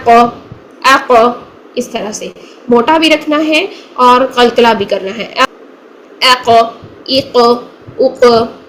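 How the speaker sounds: अ क with a heavy bouncing stop; qalqala qaf. Shown as a demonstration. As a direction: hit क hard so it bounces. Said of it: "कैसे?". (क पर कलकला)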